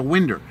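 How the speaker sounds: "winder" with an -er ending; friend's Tennessee pronunciation. Retold or imitated. Telling what his buddy calls it, "winder" with final -er. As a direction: put an r on the end of "winder". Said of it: "he calls it a winder".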